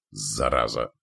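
low curse, held back; muttered curse. A narrator's reading. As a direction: swear low to yourself. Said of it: "выругался он". (тихое низкое ругательство)

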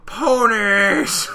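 loud, high drawn-out wail; gasp, then cry out as the troll; mock anguished cry.

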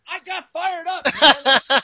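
high, laughing outburst. Real, not acted. Host owning up to taking over the bench, laughing. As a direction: admit it loudly through laughter. Laughing admission after the rant.

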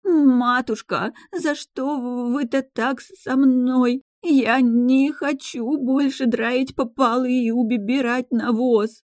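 slow, high tearful wail; fake-sob it like a wronged servant girl; wailing lament. A narrator's reading. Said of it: "начала причитать".

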